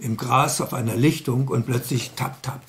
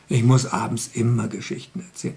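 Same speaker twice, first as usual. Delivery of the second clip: ordinary delivery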